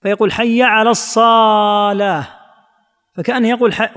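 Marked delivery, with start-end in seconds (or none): 0.3-2.3 "الصلاة" drawn out as "as-saaalaah"; stretched saad. Shown as a demonstration. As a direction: slowly hold the vowel after the s in "الصلاة". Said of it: "يمد الصاد نفسها". (مدّ صاد «الصلاة»: الصااالاه)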